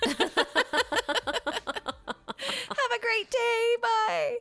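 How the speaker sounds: high, loud, sing-song sign-off; mock cheery goodbye. To a passenger, faking cheer to end the ride: high sing-song goodbye. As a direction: chirp it brightly to get away fast.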